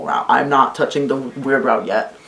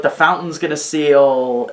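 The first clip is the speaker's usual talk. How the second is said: ordinary delivery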